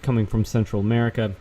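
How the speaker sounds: usual speaking voice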